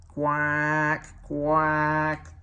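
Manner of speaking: big engines teasing Duck as they pass; wheezing quack. A narrator's reading. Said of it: "they wheezed".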